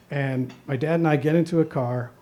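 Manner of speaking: ordinary delivery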